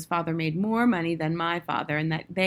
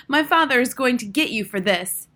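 put-on high, loud outraged boy; raised-voice threat, indignant; shrieking threat; 6 semitones higher, 6 dB louder than usual. A narrator's reading. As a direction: shriek it like a tattling child. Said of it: "he leapt up shrieking".

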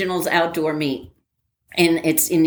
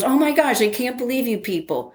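higher, mock-exasperated lieutenant; 7 semitones higher than usual. Lieutenant scolding the cadets in the stands, mock exasperated. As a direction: huff it out like an officer fed up with cadets. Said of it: "he goes".

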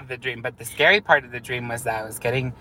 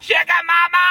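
high yelled catchphrase; high, loud fan squeal; excited shout; 4 dB louder than usual. Pretend.

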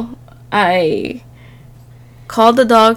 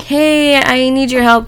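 higher-pitched mild call for help; calling over; 5 semitones higher than usual. Retold or imitated. Ex calling her to the door, mildly raised call. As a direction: imitate someone calling from the front door.

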